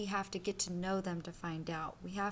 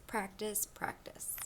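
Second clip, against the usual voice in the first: each practice softer, the last one whispered; fading repetition; 7 dB quieter, slower than usual. Acted. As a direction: let practice fade to a whisper. Repeating word, fading away.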